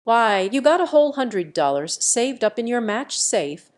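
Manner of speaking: plain reading voice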